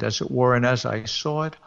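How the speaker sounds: ordinary delivery, nothing marked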